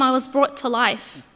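ordinary talk

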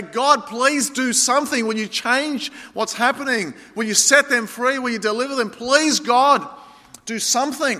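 high, pleading cry to God; anguished prayer, pitch lifted. Retold or imitated. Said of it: "you break down and you say".

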